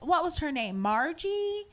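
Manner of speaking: feigned forgetting. Retold or imitated. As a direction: play dumb about the name, voice lifting up. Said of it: "She's like".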